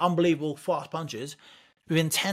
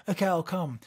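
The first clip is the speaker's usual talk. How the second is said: put-on higher-pitched, sniffly boy agreeing reluctantly; snotty crying kid; 4 semitones higher than usual. Beaten friend agreeing to try the boxing club, snotty kid voice. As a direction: imitate a beaten friend giving in. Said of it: "just in that voice?".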